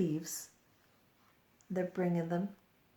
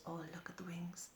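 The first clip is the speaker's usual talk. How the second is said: whisper; 14 dB quieter, faster than usual. Real, not acted. Aside to child.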